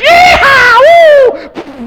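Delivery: very high, full-volume yeehaw; thrill-ride whoop. Retold or imitated. Grandchild rides Ma's rumbling lap, wildly thrilled. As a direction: whoop like you're on a roller coaster.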